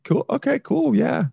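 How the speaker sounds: high-pitched polite brush-off; high, placating fake okay; feigned approval. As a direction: act like you're impressed, voice lifted high. Imagined reply to a boaster.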